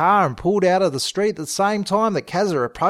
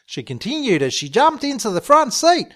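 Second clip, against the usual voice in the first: usual speaking voice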